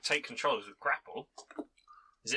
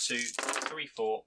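usual speaking voice

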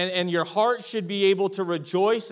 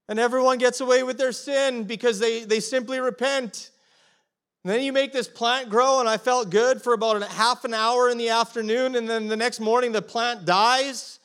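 indignant complaint; 6 semitones higher, 5 dB louder than usual. Retold or imitated. Jonah's complaint continues.

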